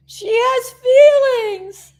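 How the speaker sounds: high, loud, slow declaration; high loud she has feelings; emotional outburst. Real, not acted. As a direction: proclaim it high and drawn out. Emotional self-talk about tears.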